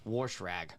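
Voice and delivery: Warschrag said slowly for Washington; Pittsburgh Warschrag. Shown as a demonstration. Guest recalling a word she got teased for, slow Warschrag. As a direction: drag out Warschrag like a local. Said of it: "what I picked up that got a little picked on".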